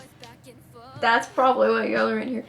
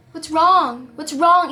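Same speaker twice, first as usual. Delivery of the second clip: louder call of what's wrong, falling at the end; retold yell; 5 semitones higher, 8 dB louder, slower than usual. Boyfriend calling to Carson from the stretcher, a retold yell. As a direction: call it across the room, alarmed. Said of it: "He was yelling at me from across the room".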